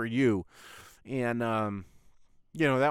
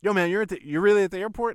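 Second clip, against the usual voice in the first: louder, higher mock disbelief; surprised friend; 5 semitones higher, 5 dB louder than usual. Best friend on the phone, surprised he's at the airport. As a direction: sound amazed he's really gone. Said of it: "he was like".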